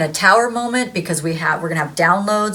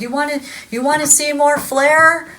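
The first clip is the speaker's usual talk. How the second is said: mock yell at the boss; 9 semitones higher than usual. Office Space waitress yelling at her boss, a high mock yell. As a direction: snap it at your boss like you've had enough. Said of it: "yelling at her boss".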